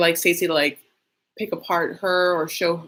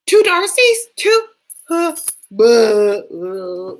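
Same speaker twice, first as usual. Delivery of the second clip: much higher, louder stunned sputter; mock shocked stammer; 5 semitones higher, 9 dB louder, much slower than usual. Viewer seeing the twins for the first time, mock stunned. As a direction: sputter in disbelief.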